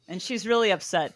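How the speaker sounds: normal talking voice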